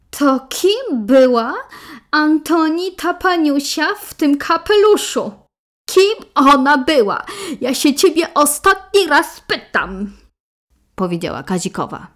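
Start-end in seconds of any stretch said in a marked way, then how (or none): 0.1-10.1 angry. Acted. Wife confronts husband about woman.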